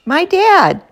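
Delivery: high, loud pupil's call-out; shouted out. A narrator's reading. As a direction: blurt it out loudly to the class. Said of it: "a voice shouted out".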